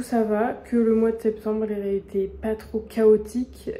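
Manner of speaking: ordinary talk, nothing marked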